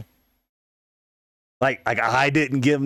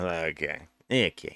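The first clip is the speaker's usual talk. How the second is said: sheepish backing down; 8 semitones lower, 8 dB quieter than usual. Retold or imitated. Backing down to sulking friend.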